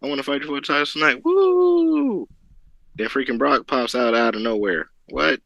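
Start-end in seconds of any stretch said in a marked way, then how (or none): 0.0-2.3 higher, hyped, rising to a big woo; mock-hyped fan ending in a high woo; excited fan reaction. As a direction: act out cheering the challenge. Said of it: "I'm like".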